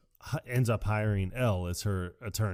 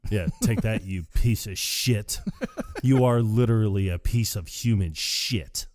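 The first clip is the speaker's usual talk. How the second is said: louder triumphant jab, slowing on piece of shit; gloating insult; 6 dB louder than usual. Retold or imitated. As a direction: rub it in his face, louder. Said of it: "he looks right at Callahan like".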